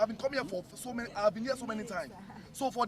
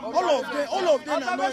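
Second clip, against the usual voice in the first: shouting; 6 semitones higher, 6 dB louder than usual. Real, not acted. Student leader shouting objection.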